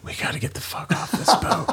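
hissed under his breath; whispering to his girlfriend to get off the boat; urgent whisper. Retold or imitated. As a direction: whisper it so the guide can't hear. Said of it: "and I'm like".

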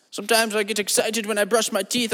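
ordinary delivery

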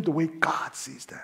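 slow, soft, fading to a whisper; hushed emphasis. Real, not acted. Preacher landing his point to the congregation, hushed. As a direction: let the phrase sink away almost voiceless.

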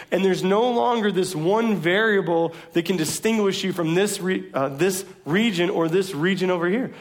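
fervent sermon delivery; preaching. Acted. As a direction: preach it out to the congregation. Preacher at full force opening.

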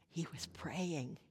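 soft, slow whispered reveal; hushed, slow reveal. Acted. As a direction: drop to a whisper for the answer. Answer to rhetorical question.

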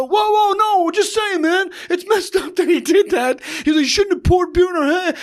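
high-pitched, pleading mock voice; alarmed backpedaling. Imitating guys backing off from the fight, high and flustered. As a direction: back down in a squeaky fluster. Retold men backing down from meltdown.

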